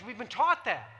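normal talking voice

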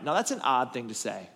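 usual speaking voice

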